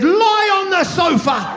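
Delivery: high, slowish hammed-up order; mock bossy midwife order; mock babushka command. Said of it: "and then she said".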